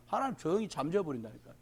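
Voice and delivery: ordinary delivery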